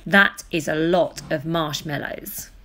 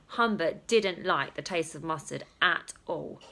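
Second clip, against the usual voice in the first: plain reading voice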